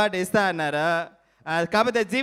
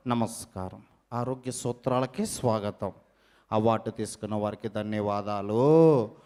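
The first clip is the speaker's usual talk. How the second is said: much lower, softer TV host; health show host; 10 semitones lower, 4 dB quieter than usual. Acted. Mimic welcomes viewers as a much lower health show host.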